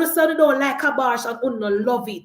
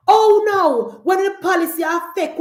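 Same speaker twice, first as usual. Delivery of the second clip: higher and louder, exaggerated dismay; mock alarm; 7 semitones higher, 4 dB louder than usual. Real, not acted. Mocking Trump voters' panic, loud mock dismay. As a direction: fake shock at their trouble.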